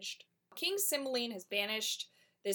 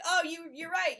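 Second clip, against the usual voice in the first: mock caught-out villain; 9 semitones higher, 7 dB louder than usual. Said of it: "He's like".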